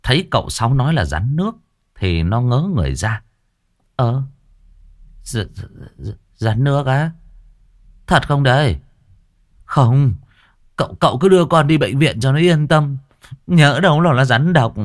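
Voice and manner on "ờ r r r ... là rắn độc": raised, stammering disbelief; stammering doubtful lad. Acted. Tâm doubts uncle's word, stammering pleas. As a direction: stutter then insist on hospital.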